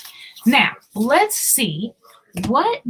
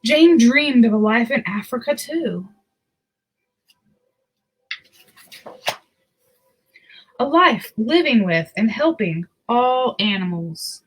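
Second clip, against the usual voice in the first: put-on reading a picture book to children; story narration. A narrator's reading. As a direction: tell it gently to little listeners.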